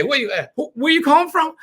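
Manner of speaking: high, rising question; curious prospect. Retold or imitated. Prospect asking the caller where from, high. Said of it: "and they say".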